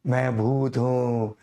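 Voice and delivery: slow, drawn-out spooky ghost line, lightly done; mock movie ghost. Teacher jokes to students about his echoing voice, slow mock ghost. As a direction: play a film ghost with a hoarse echoing throat, half joking. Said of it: "फिल्मों में वो special effect डाल के".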